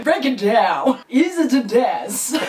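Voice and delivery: hyped, loud DJ hype call. Retold or imitated. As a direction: shout it like a club DJ. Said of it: "[name]さんみたいじゃないですか".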